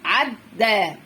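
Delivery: عدى read as two syllables with doubled د; teacher's syllable-by-syllable عدى; split reading. Shown as a demonstration. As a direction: sound out عدى, pausing on the د. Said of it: "طب اقرأ كده بقى".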